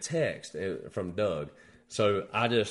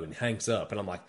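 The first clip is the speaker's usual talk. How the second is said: ordinary talk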